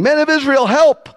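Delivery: high, urgent call for help, acted; shouting. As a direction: yell out to rally a crowd. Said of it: "They're shouting".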